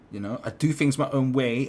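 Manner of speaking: mock swagger; mocking meme voice. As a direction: parrot a smug nineties meme. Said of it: "It was like".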